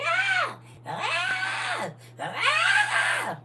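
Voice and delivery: very high mock baby shriek; three piercing wails like a screaming baby; imitated baby scream. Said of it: "Babies, when they".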